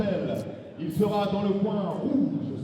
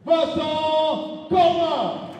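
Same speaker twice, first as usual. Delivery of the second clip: very loud, very slow and higher; booming ring announcer; 8 semitones higher, 10 dB louder, much slower than usual. Real, not acted. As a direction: drag the name out to the crowd. Ring announcer calls fighter name.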